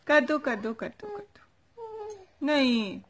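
very high, slow, whimpery coo. Real, not acted. Aunt pleading with the baby for a smile, high whimpery coo.

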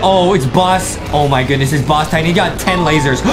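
normal talking voice

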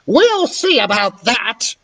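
high, slow, threatening crone; menacing old woman. A narrator's reading. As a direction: promise trouble like a spiteful hag. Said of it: "shouted the woman".

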